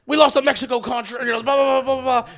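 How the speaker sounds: high, loud panicked call home; frantic phone call. Retold or imitated. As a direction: yell the bad news to the wife. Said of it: "going outside to call their wives".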